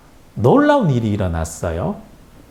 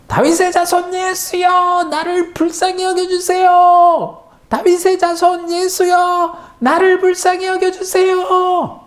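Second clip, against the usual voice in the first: very high, slow all-out plea; crying with all his might; 3 dB louder than usual. A narrator's reading. Blind man crying out with all his strength, very high slow plea. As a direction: throw every ounce of strength into the cry. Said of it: "모든 힘을 다해서 더 큰 소리로 외쳤답니다".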